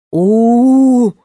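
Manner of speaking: loud rolling howl; a crowd's long roar. A narrator's reading. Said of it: "прокатилось по площади".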